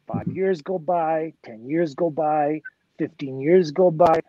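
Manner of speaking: slow. Real, not acted. Slow build of passing years.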